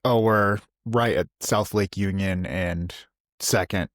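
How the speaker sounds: usual speaking voice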